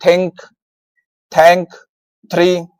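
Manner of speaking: think, thank, three with an aspirated dental थ. Shown as a demonstration. Teacher showing TH examples, Hindi थ sound in think, thank, three. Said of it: "टीएच को थ बोला जाता है".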